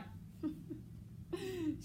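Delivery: disdainful laugh. Shown as a demonstration. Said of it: "remember he's been laughing".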